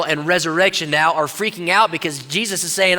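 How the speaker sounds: ordinary talk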